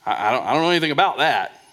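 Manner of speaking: hammed-up dodging churchgoer; mock reluctant believer. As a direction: rattle off the excuse.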